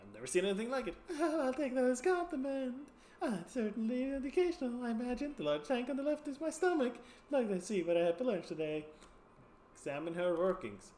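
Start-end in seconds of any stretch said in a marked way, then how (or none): 1.2-8.9 high, pleased carnival lady voice; proud of her clockwork insides; Janet in the box. Acted. Janet showing her workings.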